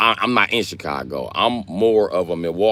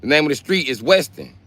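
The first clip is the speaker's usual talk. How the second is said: heated insist; 7 semitones higher than usual. Real, not acted. Arguing with chat over the street name.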